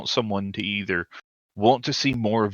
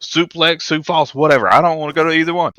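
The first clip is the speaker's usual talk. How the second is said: much higher, louder, fast wrestler babble; mock R-Truth rant; 8 semitones higher, 6 dB louder, faster than usual. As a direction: rattle it off high and giddy. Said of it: "He goes".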